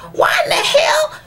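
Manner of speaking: high cry-shout; crying shout of frustration; real outburst. Real, not acted. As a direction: it bursts out of her, near tears. Outburst about the absent father.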